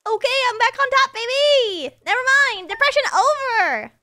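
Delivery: loud, high, fast celebration; excited cheering. Real, not acted. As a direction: shout it out in triumph. Celebrating game money.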